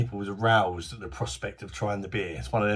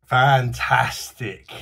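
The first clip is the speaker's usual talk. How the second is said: delighted; 8 dB louder, much slower than usual. Real, not acted.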